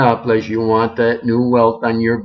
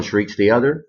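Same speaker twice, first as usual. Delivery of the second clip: usual speaking voice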